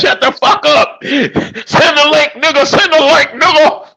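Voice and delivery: high, loud, laughing yell; laughing shout. Real, not acted. Friend yells at the host on the call to send the link, laughing shout. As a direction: holler playfully at a friend, cracking up.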